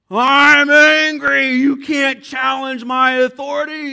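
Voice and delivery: very high, very loud hammed-up shout; comic roaring Moses, slow on angry; mock Hulk rage. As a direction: blow up like a cartoon monster. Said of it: "he loses his stuff".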